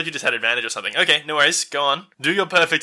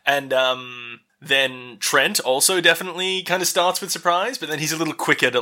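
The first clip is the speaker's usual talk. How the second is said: normal talking voice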